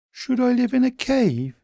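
high, small puzzled bunny voice; a high, wondering little creature; Daily B's voice. A narrator's reading.

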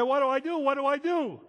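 high, loud pleading cry over the crowd; desperate shout. Retold or imitated. Man calling to the pastor as soldiers pull him away, loud desperate cry. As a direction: shout it like a man begging for an answer. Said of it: "He said".

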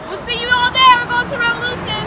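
calling out. Acted. Calling out to a crowd.

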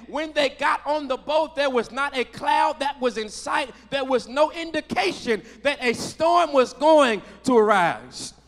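sermon delivery building in intensity; impassioned preaching. Real, not acted. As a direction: preach it with rising force, punching key words. Preaches at raised pitch and volume.

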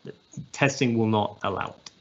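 ordinary delivery

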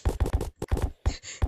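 loud unvoiced laugh; loud burst of laughter. Real, not acted. Friend's insult amuses him.

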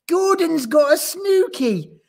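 put-on very high, loud childish taunt; screeching. A narrator's reading. Smallest gorilla exposes Gordon's secret, screeching. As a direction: screech it out gleefully. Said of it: "screeched Abu".

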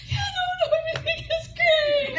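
tearful high-pitched reassurance; crying. Retold or imitated. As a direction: force out a brave answer while crying. Crying on phone to pastor.

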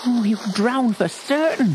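high, slow, worried inner voice; fearful thought. A narrator's reading. Billy fearing his pony will drown, high and slow. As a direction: dread the horse is lost. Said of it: "thought Billy".

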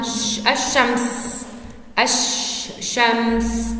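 الشمس slow, with a long held sh; doubled-sound demonstration. Shown as a demonstration. Teacher showing how it is pronounced, الشمس with lengthened sh. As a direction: stretch the sh in الشمس, then split the word after it. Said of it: "نلفظها؟".

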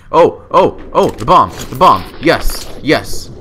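loud, high excited yelps; excited shout. Real, not acted. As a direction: shout it out, thrilled. Spots the bomb in game.

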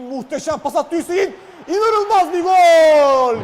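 slow, drawn-out roaring goal call; screaming football commentator. Real, not acted. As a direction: stretch out the roar. (uzatarak haykıran spiker)

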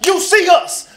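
high, loud mocking taunt; menacing gang member. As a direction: taunt from the car window. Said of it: "they start following".

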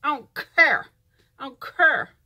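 loud southern I don't care, cur; southern drawl. Retold or imitated. Vlogger mocking a southern accent, loud drawled care. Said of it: "these accents kill me".

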